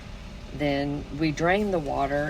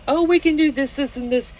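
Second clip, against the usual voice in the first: upbeat Dustin; 9 semitones higher, 6 dB louder, faster than usual. Retold or imitated. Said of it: "Dustin's in there".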